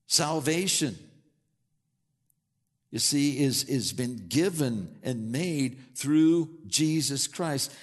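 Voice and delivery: solemn pulpit emphasis; preaching. Acted. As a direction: lay each phrase down slowly from the pulpit. Preaching slow emphatic passage.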